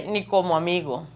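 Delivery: ordinary talk, nothing marked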